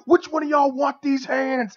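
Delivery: loud, high, drawn-out yell; imitated wrestler's scream. Wrestler taunting opponents in the ring, screaming. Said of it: "So he screams".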